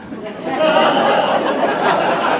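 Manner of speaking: laugh. Real, not acted. Laughs after joke.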